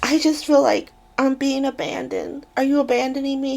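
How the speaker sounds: a mock plea of being abandoned; whiny Maria. Woman asking her friend if he's abandoning her, mock whiny. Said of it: "she's like".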